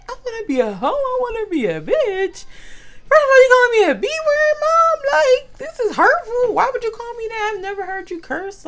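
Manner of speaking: high and loud, hurt and indignant; offended outrage. Retold or imitated. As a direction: protest like a kid who's been insulted. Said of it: "I'm like".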